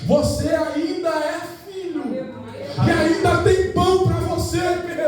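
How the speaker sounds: high, drawn-out preacher's cry; calling out. Acted. Jesus calling to someone far away, high impassioned shout. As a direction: call it slowly across a great distance. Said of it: "Jesus tá gritando pra alguém que tá longe".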